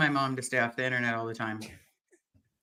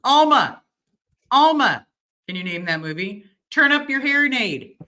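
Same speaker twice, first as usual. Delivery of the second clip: calling out; 8 semitones higher, 5 dB louder than usual. Retold or imitated. Calling out a movie quote.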